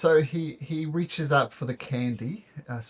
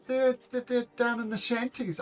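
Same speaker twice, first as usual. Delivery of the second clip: put-on high, lightly tearful child voice; sobbing kid; 9 semitones higher than usual. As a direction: play a kid still sobbing a little. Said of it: "sobbing a little bit".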